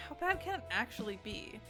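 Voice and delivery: usual speaking voice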